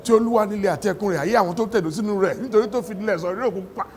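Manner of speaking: rapid-fire recitation of a Yoruba prayer; fervent Yoruba prayer. Retold or imitated. Imitating mother praying in Yoruba, fast fervent recitation. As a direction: rattle off the scripture like a praying mother. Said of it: "you could feel the vibrations".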